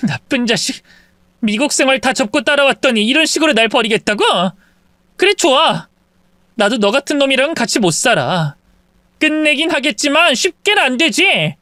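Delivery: bitter wife lashing out; hurt, angry wife. Acted. Wife abandoned after leaving America, bitter. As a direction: bite back at him.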